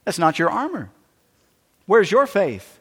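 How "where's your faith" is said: ordinary delivery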